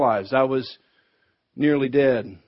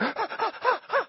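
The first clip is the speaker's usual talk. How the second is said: excited dog laugh; 10 dB quieter than usual. Retold or imitated. Dog's excited reaction.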